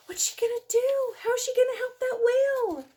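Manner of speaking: put-on a child dying to know what happens; eager wondering. Reader voices eager listener.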